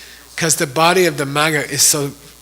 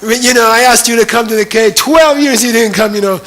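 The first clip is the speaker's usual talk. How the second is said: retold outburst, higher and louder, scolding; angry reproach; 5 semitones higher, 5 dB louder than usual. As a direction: scold Maitreya for twelve years of waiting. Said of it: "Then he got angry at Maitreya".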